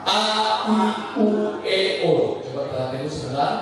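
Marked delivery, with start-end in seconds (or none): 0.0-2.4 A, I, U, E, O sung out high and slow, mouth wide; open vowel drill. Shown as a demonstration. Trainer leads the class in a vowel drill, mouth wide. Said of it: "Harus buka banget".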